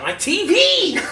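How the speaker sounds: high mock panic; retold alarmed cry. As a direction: cry out for a prized possession. Retells panic as he's shoved.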